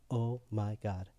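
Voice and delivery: low, hushed shock; under-the-voice disbelief; stunned realisation. Retold or imitated. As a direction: let it out softly, stunned. Realises what he just said.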